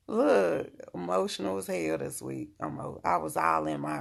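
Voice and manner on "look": ordinary talk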